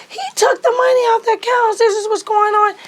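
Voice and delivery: high, loud frustrated protest; desperate plea. Retold or imitated. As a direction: make them listen, pleading hard. Pleading with court.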